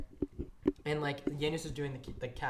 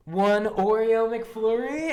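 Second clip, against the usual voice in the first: slow disbelief; 6 semitones higher, 11 dB louder, much slower than usual. Retold or imitated. Coworker reads order in disbelief.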